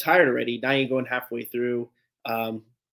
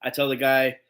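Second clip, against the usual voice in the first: ordinary delivery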